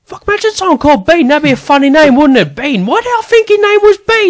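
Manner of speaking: very high, loud, fast imitation; hammed-up impression of a man tickled by a name; rambling tangent. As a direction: rattle it off loud, far above your own pitch. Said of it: "goes on his own little tangent".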